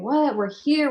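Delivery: high, loud startled cry; can't believe they're lost; frantic disbelief. Retold or imitated.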